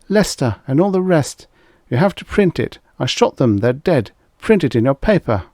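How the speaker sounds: high, loud urgent pleading; frantic woman begging the editor to publish; desperate demand. A narrator's reading. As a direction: push him to print it.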